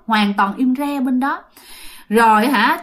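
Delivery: ordinary delivery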